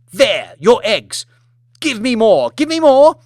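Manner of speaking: loud, high, rising screamed demand; furious shout. Acted. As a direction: hurl the words as you slam the plate. Lady slamming plate, demanding more.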